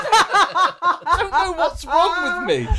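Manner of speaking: high, drawn-out words through laughter; real fit of laughter; laughing helplessly. Real, not acted. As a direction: crack up while confessing. Cracking up at own funeral gaffe.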